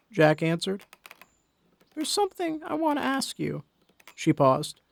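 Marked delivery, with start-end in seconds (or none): 1.9-3.6 put-on high, soft frail old woman; old Molly. Old wife asks her husband something, soft and high. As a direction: ask gently in a thin old voice.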